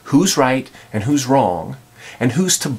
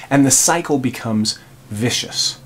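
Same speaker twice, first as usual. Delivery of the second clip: ordinary delivery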